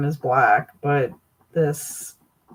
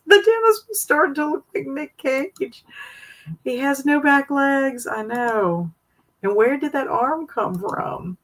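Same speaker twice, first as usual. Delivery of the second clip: high, laughing voice; 9 semitones higher than usual. Real, not acted. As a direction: talk through the giggles. Laughing at bizarre pictures.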